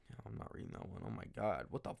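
hushed muttered refusal; whispered aside. Real, not acted. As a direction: whisper it to yourself. Aside while skimming submissions.

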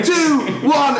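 high-pitched imitated counting; put-on urgent count, pitched right up; mock loud countdown. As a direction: mimic an over-intense helper finishing a countdown. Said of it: "he was getting louder".

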